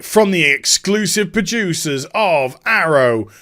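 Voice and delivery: slow, drawn-out promo voice; mock trailer announcer. Parodies a trailer's voice-over.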